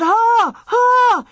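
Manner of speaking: very high, loud, drawn-out yells; yelling. A narrator's reading. Almanzo yells as the sled tips over, yelling. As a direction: holler desperately at a runaway team. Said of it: "Almanzo yelled".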